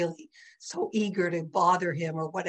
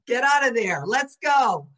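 loud acted command to a dog; calling off dog; 4 semitones higher, 7 dB louder than usual. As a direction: call out sharply, as if tugging the leash. Dog owner calls dog from hole.

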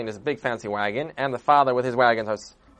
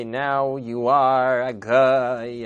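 slow, drawn-out mock blessing; mock priestly pronouncement; 4 dB louder, much slower than usual. Storyteller mocking the bishop's baptism words, slow and solemn. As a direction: intone it like a pompous priest.

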